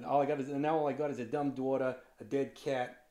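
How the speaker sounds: fast, griping New Jersey accent. Shown as a demonstration. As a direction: rattle it off like a Jersey local. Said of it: "to get into the New Jersey accent".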